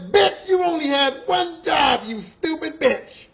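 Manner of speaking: high, loud, slow snarling insult; angry cursing. Retold or imitated. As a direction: bellow it at her. Coworker doubling down on insults.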